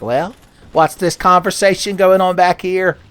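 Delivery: a hint of a teacher catching whisperers; stern teacher.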